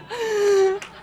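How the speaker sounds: loud high laugh, taken by surprise; burst of laughter. Real, not acted. Actress laughs at fan's date request, high burst of laughter. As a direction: crack up out loud. Asked out by audience member.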